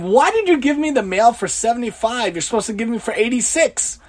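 hammed-up irritated mail complaint; mock complaint. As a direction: chase him down and scold him. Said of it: "and said to him".

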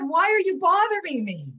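high, loud mock outrage; husband parroting the rabbi's brusque question; indignant retold quote. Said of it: "Well, you heard what he said".

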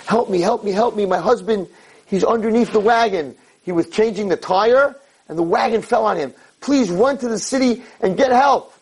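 high, urgent cry for help; mock screaming. Woman whose husband is under the wagon, acted screaming plea. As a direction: plead frantically, an imitated scream short of full volume. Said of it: "she's screaming".

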